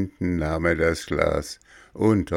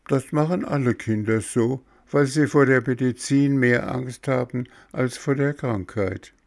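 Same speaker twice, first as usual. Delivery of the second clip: lightly voiced child, pitched up; a touch of high boyish chatter; lively puppet boy; 7 semitones higher than usual. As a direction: answer back like a pert little boy. Voices recovered Bengele.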